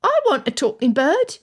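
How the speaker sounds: put-on high, eager little boy; young boy voice. A narrator's reading. Young lad to Elizabeth, high eager boy voice. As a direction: play the lad pleading for a dog.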